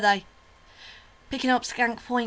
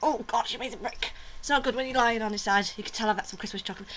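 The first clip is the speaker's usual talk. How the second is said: ordinary delivery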